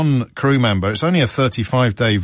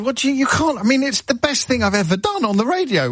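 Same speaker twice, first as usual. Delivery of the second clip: high, indignant protest; outraged at losing the tape, pitched up; cross retort; 12 semitones higher than usual. Retold or imitated. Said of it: "I got quite cross".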